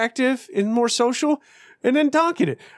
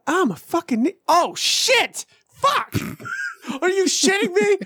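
rising yells of knee pain, higher and higher; mock pained yelling; 9 semitones higher than usual. Man on the stairs yelling at his knee, rising mock pain. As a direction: ham up the pain on every step.

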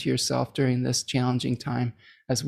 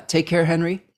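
normal talking voice

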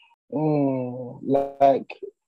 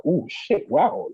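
impressed; 7 semitones higher, slower than usual. Retold or imitated. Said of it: "it was like".

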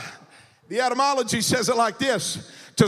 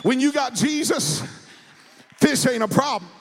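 full-force preaching. Acted. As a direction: rouse the church to praise. Preacher builds the refrain over music.

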